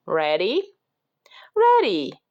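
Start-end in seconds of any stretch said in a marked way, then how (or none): 1.6-2.2 high, loud storybook reply; eager shouted answer in a high voice. A narrator's reading. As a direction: shout back high, as Jack. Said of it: "shouted Jack".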